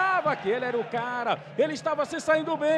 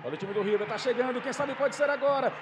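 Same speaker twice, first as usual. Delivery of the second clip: normal talking voice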